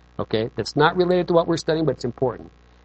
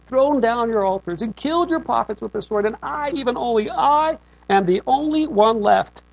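lightly silly, rising higher as the complaint builds; silly Elijah voice; 7 semitones higher than usual. Acted. Preacher reading Elijah's complaint in a silly voice. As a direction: play Elijah as a whiny, self-pitying prophet. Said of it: "do a silly voice with Elijah".

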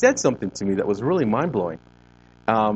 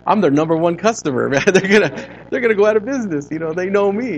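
mock panic; 10 semitones higher, 7 dB louder than usual. Joking panic about taco stands.

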